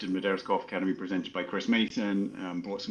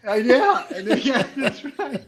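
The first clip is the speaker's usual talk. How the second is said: high, laughing agreement; chuckling along with the joke; 6 dB louder than usual. Real, not acted. Agreeing with the host's joke, laughing high. Host jokes he was original.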